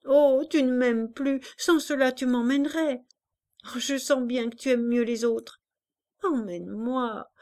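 put-on a child's tearful threat; crying child. A narrator's reading. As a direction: threaten through tears. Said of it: "Elle pleurait".

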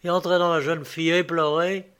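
usual speaking voice

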